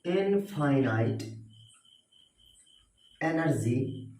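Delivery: slow, weighty quote with long pauses; teacher reciting a quote with dramatic pauses. Shown as a demonstration. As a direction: let each word of the quote land, pausing between. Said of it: "Swami Vivekananda का quotation था".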